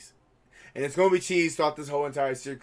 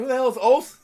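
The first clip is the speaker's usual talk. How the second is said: confused; 9 semitones higher, 6 dB louder than usual. Retold or imitated. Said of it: "I was like".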